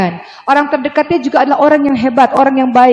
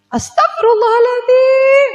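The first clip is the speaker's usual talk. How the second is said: very high, slow astagfirullahaladzim; exasperated exclamation; 13 semitones higher, slower than usual. Retold or imitated. Mother reacting to her child, slow high wail. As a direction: wail it out in disbelief.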